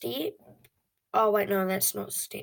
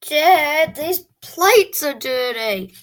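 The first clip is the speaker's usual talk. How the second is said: complaining call to dad; 6 semitones higher, 7 dB louder, much slower than usual. Retold or imitated. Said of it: "I'm like".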